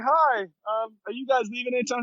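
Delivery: high, hopeful polite request; high-pitched eager question; sweet asking. Retold or imitated. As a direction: ask nicely with hope. Asking couple for a ride.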